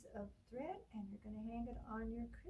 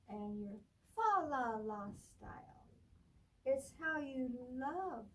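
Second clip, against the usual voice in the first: put-on reading to children; 4 dB louder, slower than usual. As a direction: read it like storytime for little ones. Librarian reads picture book aloud.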